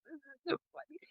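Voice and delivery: high, faint squeak through real laughter; helpless laughter. Real, not acted. Storyteller overcome by own grandma story, helpless squeaky laughing. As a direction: squeeze it out softly while cracking up.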